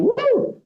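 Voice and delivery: very high yelping howl of a hurt dog. Retold or imitated. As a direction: yelp like a hound in pain. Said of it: "and the dog goes".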